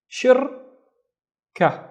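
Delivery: long gap between the syllables of "Shirka"; split into syllables. Shown as a demonstration. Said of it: "let's split this into two syllables".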